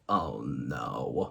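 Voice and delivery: deep, soft "Oh, no" groan; catchphrase groan, low and soft; very low dismayed groan. Retold or imitated. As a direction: drop very low and quiet in dismay. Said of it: "thinking to myself".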